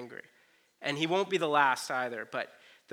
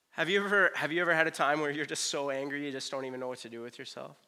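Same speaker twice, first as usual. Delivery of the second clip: normal talking voice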